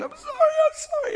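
imitated sobbing apology. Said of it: "She's bawling".

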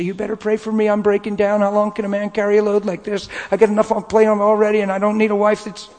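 a pretend desperate call to the pastor; hammed-up breaking-down plea; mock plea. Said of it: "call pastor and say".

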